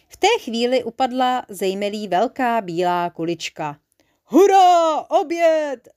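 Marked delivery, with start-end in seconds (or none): 4.2-5.9 high, loud hurrah; joyful shout at dinnertime; overjoyed cheer. A narrator's reading. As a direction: cheer loudly with delight. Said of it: "zaradoval se Hubka Pupka".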